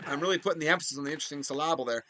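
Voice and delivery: lively hockey commentator calling the action; play-by-play announcer. Acted. Gamer commentating his hockey game to viewers in announcer voice.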